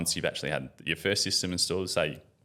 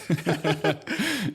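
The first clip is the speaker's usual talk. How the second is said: high laugh; 4 dB louder than usual. Real, not acted. Laughing at battery war joke.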